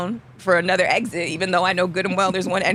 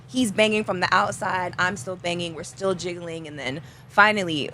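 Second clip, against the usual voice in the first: normal talking voice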